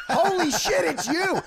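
high, loud, laughing yell of disbelief; excited yell. Retold or imitated. Blurting out loud in starstruck shock. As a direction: fan yelling at a legend he just met, laughing shout. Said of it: "I'm yelling, I'm screaming".

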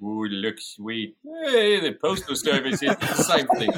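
slow, drawn-out cartoonish know-it-all impression; Cliff Clavin. Shown as a demonstration. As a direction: do a sitcom mailman's put-on voice. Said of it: "Well, Cliff Clavin".

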